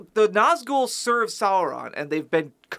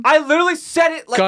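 fast, high, loud protest; tantrum; 9 semitones higher, 7 dB louder, faster than usual. Real, not acted. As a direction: insist loudly, worked up. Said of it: "Do you wanna throw a tantrum?".

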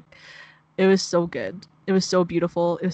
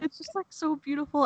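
high, softer voice on the edge of tears; tearful; 8 semitones higher, 6 dB quieter than usual. Real, not acted. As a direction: get the words out while close to crying. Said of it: "it's making me tear up".

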